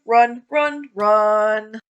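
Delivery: loud, panicked urging; being chased. Real, not acted. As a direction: flee in a hurry.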